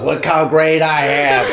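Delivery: crazed boasting. Acted. Outside his ex-girlfriend's house, hammed-up crazed boasting. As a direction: stretch it out like a deranged boast.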